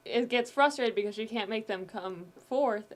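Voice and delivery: usual speaking voice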